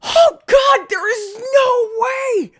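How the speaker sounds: high, loud, drawn-out disbelief; stunned yell of disbelief; shocked scream. Real, not acted. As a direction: scream it like you can't believe it. Astonished scream at game result.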